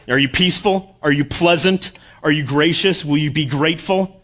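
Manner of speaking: high, loud checklist questions; hammed-up official interrogation; officious quizzing. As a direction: fire questions like a lifeguard with a clipboard. Lifeguard quizzing a drowning man.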